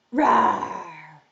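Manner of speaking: big rough lion's roar. Pretend. Teacher shows children the story cue, big lion roar. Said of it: "when you hear lion".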